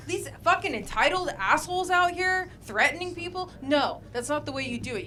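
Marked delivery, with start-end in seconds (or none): none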